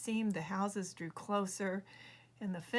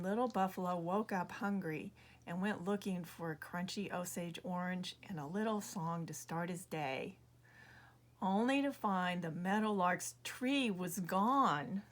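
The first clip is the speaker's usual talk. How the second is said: ordinary delivery, nothing marked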